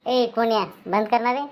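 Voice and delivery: ordinary talk, nothing marked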